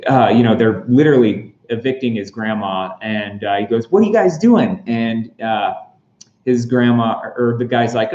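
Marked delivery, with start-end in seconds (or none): 3.9-4.8 alarmed Happy. Retold or imitated. Happy sees grandma evicted.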